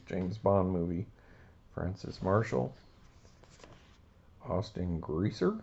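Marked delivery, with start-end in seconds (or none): none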